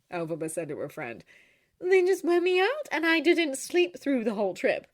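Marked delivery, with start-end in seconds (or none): 1.8-4.9 high, bright and chirpy; bright Glinda. A narrator's reading. Glinda answering Oscar brightly, high and chirpy.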